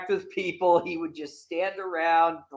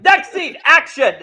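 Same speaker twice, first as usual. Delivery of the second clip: director voice; 10 semitones higher, 8 dB louder than usual. Retold or imitated. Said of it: "and then Donner's like".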